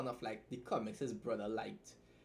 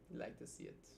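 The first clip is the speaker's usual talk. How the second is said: whispered; 9 dB quieter than usual. Real, not acted. Unvoiced aside.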